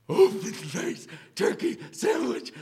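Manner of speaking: high, loud, strained mock exclamation; Kubler impression. Professor echoing his guest's lunch order, high theatrical imitation. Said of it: "like that".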